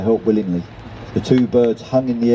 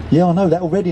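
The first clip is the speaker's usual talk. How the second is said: quick-to-answer eagerness, pitched up; eager; 6 semitones higher, faster than usual. A narrator's reading. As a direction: jump in keenly, higher voice.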